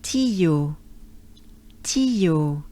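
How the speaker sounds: "Ti, yo" ligado suave, sem pausa entre as sílabas; smooth ti yo. Shown as a demonstration. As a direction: deslize de "Ti" para "yo" numa só linha. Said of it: "make it sound a bit more smooth".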